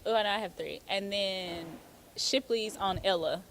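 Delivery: ordinary talk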